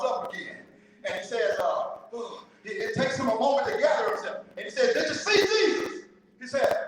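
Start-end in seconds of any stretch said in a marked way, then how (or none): 4.9-6.0 high, loud questioning; raised-voice comic demand; insistent preacher. Acted. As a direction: act out a preacher pressing for an answer. Preacher pressing dunked man in joke.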